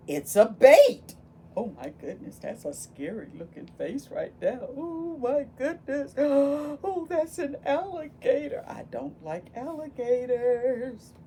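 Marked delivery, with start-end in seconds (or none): none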